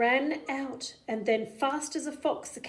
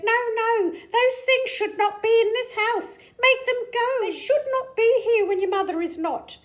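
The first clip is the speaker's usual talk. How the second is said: high, loud fretful fish; fish character voice; 12 semitones higher, 8 dB louder than usual. Acted. Fish demands the Things go, high and loud. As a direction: squeak out the demand. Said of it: "said the fish in the pot".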